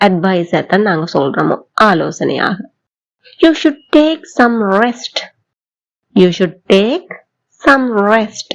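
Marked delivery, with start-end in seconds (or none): 3.4-5.2 very slow, word by word; slow model sentence. Shown as a demonstration. Teacher slowly modelling advice for learners to repeat. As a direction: stretch it out for the class to follow. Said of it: "என் கூட சேர்ந்து சொல்லி பாக்குறீங்களா".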